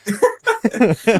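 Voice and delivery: big high burst of laughter; loud high laugh. Real, not acted. Laughing at the drunk teammate story. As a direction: crack up loud.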